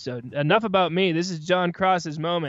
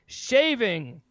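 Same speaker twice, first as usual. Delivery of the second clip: milk the impossible chore for laughs; hammed-up ad parody; 5 semitones higher than usual. Podcaster mocking a razor commercial, hammed-up despair.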